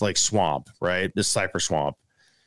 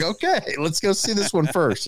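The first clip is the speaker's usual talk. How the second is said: higher, laughing-excited reply; giddy; 8 semitones higher than usual. Retold or imitated. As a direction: keep the excitement bubbling. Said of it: "I'm having a total meltdown".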